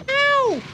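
squeaky pained ow; high little yelp. A narrator's reading. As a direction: yelp as he tumbles. Town Mouse falls over.

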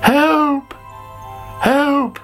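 high-pitched distressed cry; calling for help. A narrator's reading. Sick caterpillar calling for help, high alarmed cry. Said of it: "called Sammy".

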